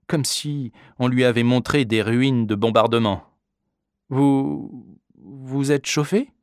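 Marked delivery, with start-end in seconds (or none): 4.1-6.3 hesitant disbelief. A narrator's reading. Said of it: "avec une drôle d'expression".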